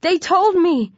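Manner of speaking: high-pitched, desperate begging; wailing. A narrator's reading. Trembling boy begging friend to save him, wailing. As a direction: beg for life in a high wail. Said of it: "Mark wails".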